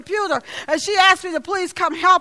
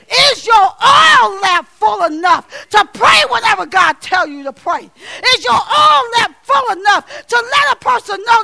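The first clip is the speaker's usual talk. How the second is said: loud, driving sermon build; shouted altar call; preaching at full force; 2 semitones higher, 3 dB louder than usual. Acted. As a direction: press the congregation hard.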